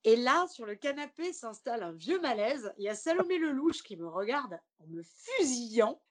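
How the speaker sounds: ordinary talk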